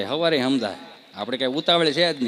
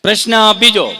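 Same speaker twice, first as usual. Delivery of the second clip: loud, higher announcement of the question; quizmaster call; 6 semitones higher, 13 dB louder than usual. Acted. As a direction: call out the next question like a game host. (Quiz સંચાલકની જેમ જોરથી જાહેરાત)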